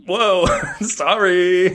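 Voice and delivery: high startled laughing sorry; taken aback, slow and laughing. Retold or imitated. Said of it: "And I'm like".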